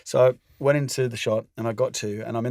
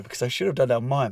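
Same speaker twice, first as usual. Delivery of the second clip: normal talking voice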